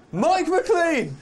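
high, loud call of a name. Real, not acted. Presenter throwing to the reporter on location, calling out.

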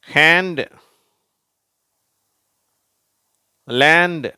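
loud, high-pitched, one word at a time; model word reading. Shown as a demonstration. Teacher reading words for pupils to repeat, loud model reading. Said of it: "நான் வாசிக்கிறேன், நீங்களும் திருப்பி சொல்லுங்க".

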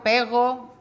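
"pego" with the r of pero swapped for a g; dropped r. Retold or imitated. Therapist imitating a child saying "pego" for pero.

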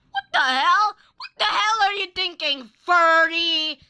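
high, loud shocked yell; shocked outburst. Real, not acted. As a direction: blurt it out in disbelief. Shocked outburst at game character.

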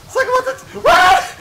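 high, loud startled what; surprised shout. Real, not acted. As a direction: break off in shocked disbelief. Surprised mid-callout, laughing.

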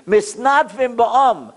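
measured, solemn recitation; slow text reading. Shown as a demonstration. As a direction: read the Hebrew very slowly. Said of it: "Listen to what the Satmar Rebbe read".

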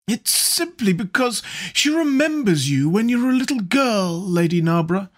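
high, languid lord; drawling wit. A narrator's reading. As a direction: toss off the quip like a bored lord. Lord Henry's witty dinner remarks.

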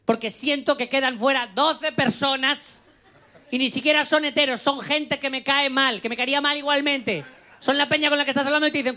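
high-pitched mock-outraged rant; worked-up stand-up grievance; comic indignation. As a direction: complain with rising exasperation, played for laughs. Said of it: "Me da mucha rabia".

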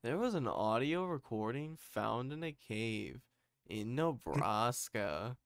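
loud, exaggerated storyteller cadence; horror story narrator. Echoing a friend's horror-story impression, hammed-up narrator. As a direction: ham up a horror-video narrator, swooping on key words. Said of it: "those horror stories that are like".

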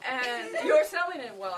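loud, high words broken by laughter; laughing. Real, not acted. Woman recalling the Australian caller's pitch, laughing. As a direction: struggle to talk through giggles.

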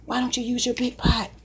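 a hint of a nosy viewer questioning her; imagined viewer asking. Cook voices viewers asking about her pan, lightly mock questioning. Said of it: "I can hear you guys asking me that".

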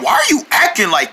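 ordinary talk

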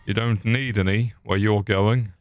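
ordinary talk, nothing marked

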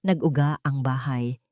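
ordinary delivery, nothing marked

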